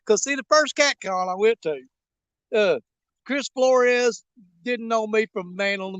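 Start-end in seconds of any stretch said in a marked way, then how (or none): none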